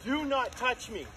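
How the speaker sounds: loud angry shouting at intruders; furious yelling. Real, not acted. Angry angler yelling at men who shoved him. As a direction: yell at them, you're livid.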